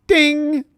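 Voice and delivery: high, loud vocal ding; imitated bell. Host with no bell marks a super chat, imitated bell. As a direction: ring out like a bell, held high. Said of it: "I don't have a bell".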